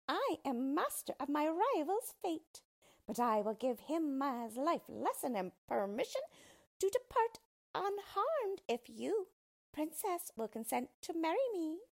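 high-pitched villainous dwarf voice; gloating yellow dwarf. A narrator's reading. Dwarf bargains with the princess over his rival, high gloating voice. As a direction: lay down terms in a high character voice.

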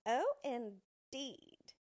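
slow, loud, haughty exclamation; dwarf looking down on her offer; disdainful. A narrator's reading. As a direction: draw it out with high-pitched scorn. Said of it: "said he disdainfully".

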